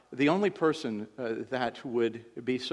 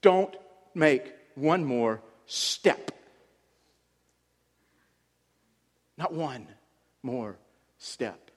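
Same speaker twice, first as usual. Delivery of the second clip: slow, spaced warning, every word weighed; slow stern command; much slower than usual. Shown as a demonstration. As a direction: drag out one more step with long pauses. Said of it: "You know what it says in the Hebrew?".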